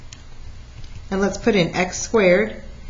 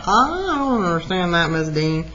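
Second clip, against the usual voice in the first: lightly mimicked student complaint, swooping up then falling; confused student; 2 semitones lower than usual. Teacher voicing students already puzzled by the example, mock confusion. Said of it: "you're already looking at that like".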